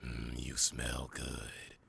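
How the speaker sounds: low, slow, breathy seductive whisper; sultry voice. Acted. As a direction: purr it low and slow in their ear. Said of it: "say in a sultry voice".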